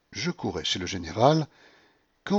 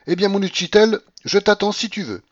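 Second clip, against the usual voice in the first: higher, louder call down the hall; flirtatious call after a departing man; calling after him; 11 semitones higher, 8 dB louder than usual. Acted. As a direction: shout after him teasingly. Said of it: "me cria-t-elle".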